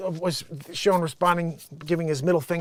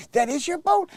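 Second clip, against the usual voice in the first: high-pitched astonished question; mock disbelief; 10 semitones higher than usual. Woman learning it is his boat, astonished. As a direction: imitate her stunned double-take, pitch jumping up.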